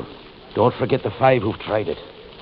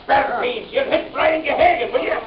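brawling shout; 11 semitones higher than usual. Acted. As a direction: roar into the brawl. Brian starting a staged brawl.

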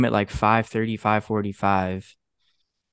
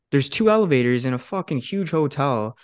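ordinary talk, nothing marked

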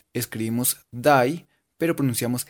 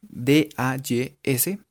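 letter by letter, drawn out; slow spelling; much slower than usual. Shown as a demonstration. Teacher spells days slowly for students.

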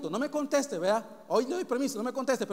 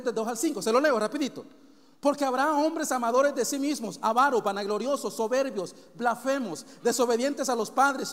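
raised, driving preacher's list; quick scripture reading; 2 semitones higher, 4 dB louder than usual. Real, not acted. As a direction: rattle off the sins with emphatic force. Se lo leo rapidito.

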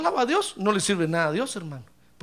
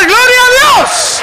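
loud, slow, high cry of Gloria a Dios; shout of praise; 14 semitones higher, 15 dB louder, slower than usual. Shown as a demonstration. Preacher shouting praise to the congregation, full voice. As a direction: belt it out to the whole church. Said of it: "vamos a gritar".

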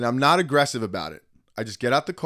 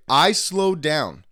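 slow, raised-pitch retold protest; insistent protest; 4 semitones higher, 4 dB louder, much slower than usual. Retelling his defence to the driver, slow and insistent. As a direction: spell out the grievance word by word, slowly.

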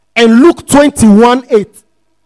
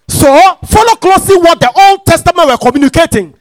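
full-force preaching; 5 semitones higher, 4 dB louder than usual. Acted. Preacher driving scripture home to the congregation, full-force preaching. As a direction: preach it out, pitch climbing towards the last word.

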